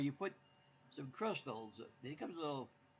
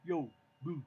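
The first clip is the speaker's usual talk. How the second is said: a louder, tough New York moo; a streetwise cow calling out; 3 semitones higher, 6 dB louder than usual. Retold or imitated. Husband answering wife with a New Yorker cow. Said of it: "How about a New Yorker cow?".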